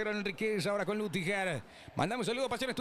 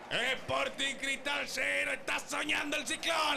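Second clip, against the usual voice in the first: excited goal commentary; 7 semitones higher than usual. Real, not acted. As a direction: keep riding the goal euphoria. Goal replay, still celebrating.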